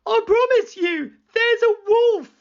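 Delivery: high, loud insistent plea; high, loud frantic promise; desperate pleading. Acted. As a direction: swear it in desperation. Boy begs villagers.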